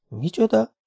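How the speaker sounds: high-pitched, astonished question to oneself; stunned whisper. Acted. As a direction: breathe it out in shock. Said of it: "suttogta Spider döbbenten maga elé". (döbbenten maga elé suttogva)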